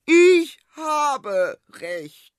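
very high, loud, slow snap; queen snapping at her daughter, high and loud. Acted. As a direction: bite each word off at her daughter. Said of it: "fuhr Serafina ihre Tochter an".

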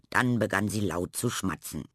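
usual speaking voice

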